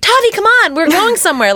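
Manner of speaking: put-on high, calling a kid along; nagging parent call. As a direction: hurry the child out the door. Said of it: "That's another fun one".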